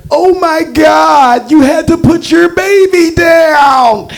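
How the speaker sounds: very high, long-drawn mock cry of sympathy. Receptionist sees the crying cat owner, high drawn-out gushing sympathy. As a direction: wail it in hammed-up pity, stretching the words.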